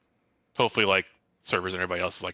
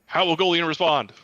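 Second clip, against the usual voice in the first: hammed-up sportscaster, a touch higher; frantic mock sports commentary; 9 semitones higher, 6 dB louder than usual. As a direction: call it like a ringside commentator. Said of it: "try to commentate as we go through".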